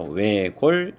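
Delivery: 외골 ending on ㄹ alone; pronunciation demo. Shown as a demonstration. Teacher showing students that only ㄹ sounds, 외골 said distinctly. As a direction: say 외골 distinctly, with no ㅅ at the end. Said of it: "이렇게 발음하는 것이 맞습니다".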